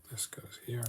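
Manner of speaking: breathy whispered repeat of the phrase. Pretend. Puzzler murmuring to himself over the pieces, whispered. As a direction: whisper it to yourself as the piece drops in.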